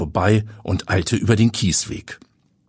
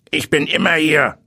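rasping graveyard watchman, higher pitch; snarling man's voice; 8 semitones higher than usual. A narrator's reading. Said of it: "schnarrte eine Männerstimme".

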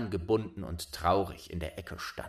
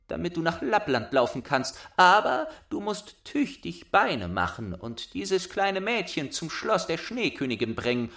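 put-on much higher, loud child voice; bossy little robber girl; 9 semitones higher, 4 dB louder than usual. A narrator's reading. Robber girl claiming Gerda, high bossy child voice. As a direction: demand it like a spoiled robber girl.

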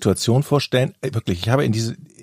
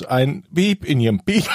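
ordinary delivery

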